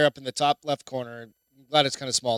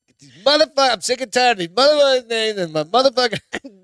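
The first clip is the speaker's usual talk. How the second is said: hammed-up yelling, much higher and louder; mock furious rant; 7 semitones higher, 5 dB louder than usual. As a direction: blow up in pretend outrage at the snakes. Echoing friend's snake rant, mock fury.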